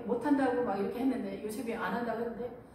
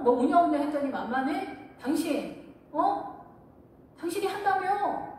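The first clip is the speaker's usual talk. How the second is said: louder, higher scolding; scolding mother-in-law; 6 semitones higher, 4 dB louder, slower than usual. Retold or imitated. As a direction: turn on her with mock outrage. Mother-in-law turning on daughter-in-law.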